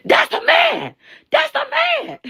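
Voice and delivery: put-on squeaky high little-kid shout; little boy. Small boy insisting to the woman. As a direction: shout it like a blunt six-year-old.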